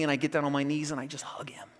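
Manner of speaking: soft, breathy hush. Retold or imitated. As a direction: drop almost to a whisper. Relieved hug after finding son.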